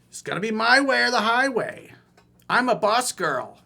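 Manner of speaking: high, loud mock bossy woman; mock bossy girl. Coach mimicking the bossy woman, high and loud. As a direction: ham up a domineering girl. Voicing her bossy stance.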